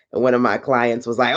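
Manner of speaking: ordinary delivery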